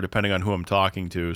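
ordinary talk

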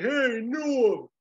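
high, loud lightly voiced "Hey, Norm!"; a rough try at Stallone calling out; half-hearted Stallone shout; Stallone impression. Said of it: "I don't know if I can do a Stallone".

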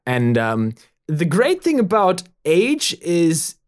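put-on deliberate, emphatic German-accented diagnosis; German doctor. German doctor explaining old age to the sheriff, German accent. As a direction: lecture like a German physician. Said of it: "The German doctor".

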